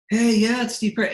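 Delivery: high-pitched phone greeting impression. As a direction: imitate him with a high, loud hello. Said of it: "I knew his voice right away".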